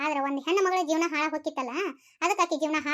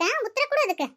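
rising higher, pressing for an answer; demanding answer; 7 semitones higher than usual. Acted. Pressing the silent elder sister for an answer, indignant. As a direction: challenge her to reply.

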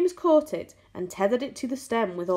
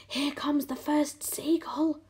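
tense, drawn-out whisper; 7 semitones higher, slower than usual. A narrator's reading. James warning the others of the seagull, whispering. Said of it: "whispered James".

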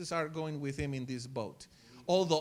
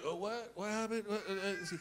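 just woken up; 5 dB quieter than usual. Retold or imitated. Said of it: "when Jesus woke up".

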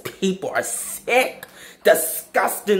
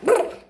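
high-pitched trilled brr; quick squeaky trill. Shown as a demonstration. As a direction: let out a short, high mouth noise. Imitated noise in a comeback.

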